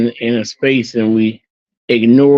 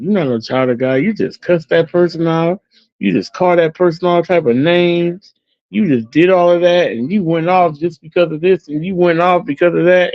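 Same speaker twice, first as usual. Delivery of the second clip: higher, mock-scolding voice of judging people; accusing onlookers; 6 semitones higher than usual. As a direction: list his faults like a crowd calling him out. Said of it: "everybody looking at me going".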